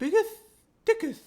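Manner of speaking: "biggest, thickest" with S's turned to "th"; lisped S's. Retold or imitated. Co-host trying out the lisp, on "biggest, thickest". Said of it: "Like, like that".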